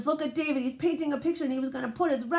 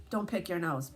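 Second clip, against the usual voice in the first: plain reading voice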